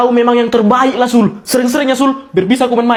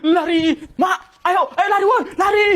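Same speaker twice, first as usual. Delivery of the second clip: higher yelled run; panicked shout; 6 semitones higher, 5 dB quieter than usual. Acted. Kids fleeing the angry uncle, panicked shouting. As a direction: yell at friends to run.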